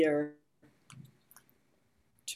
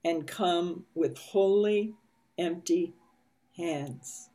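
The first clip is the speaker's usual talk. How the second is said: guided meditation reading. A narrator's reading. Reads meditation passage slowly.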